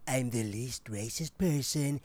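very low put-on American voice; mock American accent, dropped deep in pitch; attempted American accent. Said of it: "I try speak American".

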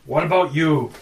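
dumb giant voice. Acted. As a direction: boom it out like a dim giant.

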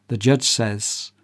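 normal talking voice

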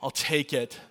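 hammed-up reluctant giver; grudging. A pretend reluctant Jesus giving in, mock-grudging. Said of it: "Jesus isn't like".

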